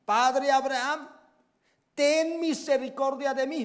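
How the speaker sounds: high, loud desperate cry from the flames. Acted. Tormented rich man cries out to Father Abraham, loud and desperate. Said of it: "él dando voces dijo".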